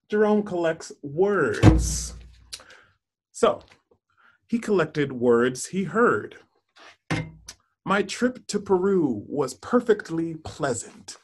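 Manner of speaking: put-on storybook narration for children; story reading. Teacher reads picture book aloud.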